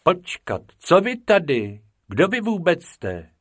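high, startled questioning; taken-aback schoolmaster; suspicious challenge. A narrator's reading. Teacher challenges the two intruders.

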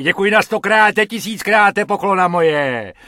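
over-the-top jubilant gratitude; gushing thanks. A narrator's reading. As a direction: pour out thanks in a rush. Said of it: "volá harmonikář ... nýbrž rozjařeně".

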